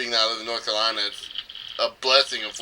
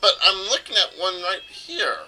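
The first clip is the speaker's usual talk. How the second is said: lightly voiced high hero; 6 semitones higher than usual. Voicing Mario to the mayor.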